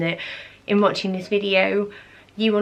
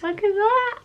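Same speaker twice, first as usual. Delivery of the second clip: delighted squeal; 13 semitones higher than usual. Real, not acted. Showing her fourth birthday dress.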